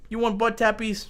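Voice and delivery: high cooing question to the cat. Real, not acted. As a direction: coo up high to a pet. Baby talk to cat.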